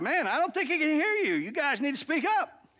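loud, high taunting jeer; mock them across the mountain; loud, mocking taunt at the prophets; trash talk. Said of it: "He starts trash talking 'em".

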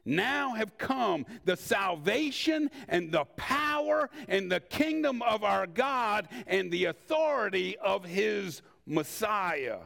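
usual speaking voice

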